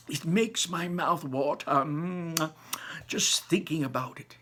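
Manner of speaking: put-on high, lively old man voice; a grandpa bursting with wonder; old grandfather. Grandpa Joe dazzling Charlie with Wonka's inventions, high and lively.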